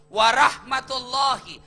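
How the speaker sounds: Warahmatullahi said slow and loud; emphatic warahmatullahi. Shown as a demonstration. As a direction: stress each syllable of Warahmatullahi. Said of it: "Tiga isi dalam doa".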